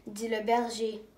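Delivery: ordinary delivery, nothing marked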